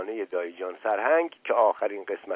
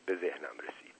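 ordinary delivery, nothing marked

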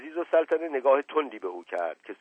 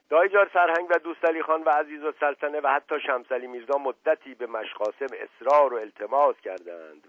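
plain reading voice

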